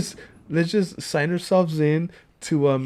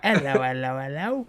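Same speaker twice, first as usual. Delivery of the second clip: put-on Cockney accent; 4 semitones lower than usual. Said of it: "the Cockney accent".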